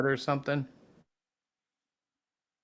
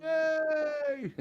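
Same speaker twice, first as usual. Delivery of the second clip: high, drawn-out squeal of yay; excited cheer. Real, not acted. Cheering the website update.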